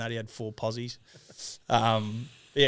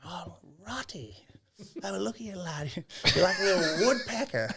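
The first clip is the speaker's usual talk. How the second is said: higher-pitched, hammed-up Irish coach voice; strong Irish accent; 8 semitones higher, 3 dB louder than usual. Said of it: "in his strong Irish accent".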